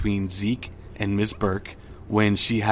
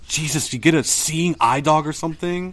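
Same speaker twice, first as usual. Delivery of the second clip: retold sarcastic jab; 7 semitones higher than usual. Retold bickering after bumping.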